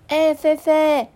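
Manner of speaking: high, drawn-out friendly call. Acted. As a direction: hail your friend high and slowly. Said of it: "这时候玲玲就说". (同学招呼的语气)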